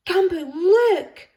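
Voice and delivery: high, loud, slow excited call. A narrator's reading. The little girl calling to Gumboot, a high, loud excited call. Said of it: "The little girl was calling".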